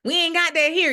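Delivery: loud, high indignant quip; mock outrage. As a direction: throw it out like a put-out shopper. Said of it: "We like".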